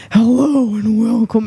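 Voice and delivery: lightly voiced public radio host; NPR host. Mimics how NPR sounds in the ears, radio host voice. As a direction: up close on the mic like NPR. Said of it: "That's how N P R sounds in my ears".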